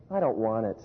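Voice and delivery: imitating his son's glum no; kid's flat refusal. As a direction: play the disappointed little boy turning it down. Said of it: "He goes".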